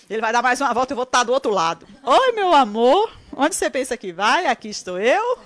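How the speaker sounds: spring the trap with a sweet "Oi, meu amor"; playful sweet trap. Pretend. Wife surprising husband on the other side, sugary and teasing. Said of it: "Tô falando em tom de brincadeira".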